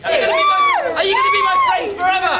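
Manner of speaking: very high, loud shriek. Acted. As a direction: squeal it giddily. Shrieking question to crowd.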